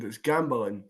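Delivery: gambling with the final G dropped, gamblin; Scottish pronunciation. Shown as a demonstration. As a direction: say gamblin, no G at the end. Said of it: "pronounce the G's at the end".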